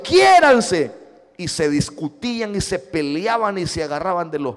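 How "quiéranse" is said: parental shout. Retold or imitated. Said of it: "decía yo".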